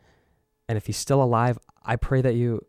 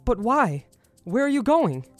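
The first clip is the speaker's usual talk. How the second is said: high pleading disbelief; shocked; 12 semitones higher, 7 dB louder than usual. Acted. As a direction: cry out, stunned. Mentor announces he is leaving.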